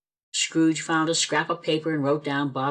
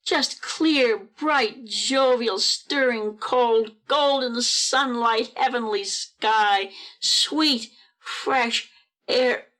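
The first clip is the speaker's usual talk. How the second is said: high, savouring each word in wonder; exultant; 7 semitones higher, much slower than usual. A narrator's reading. Narrator at Scrooge's open window on a glorious morning, exultant. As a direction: ring out each phrase in rapture, unhurried.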